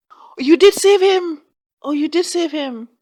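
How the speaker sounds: high, loud indignant cry; outraged at the court's bias, crying out high and loud; outraged disbelief. Real, not acted. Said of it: "outraged at the verdict".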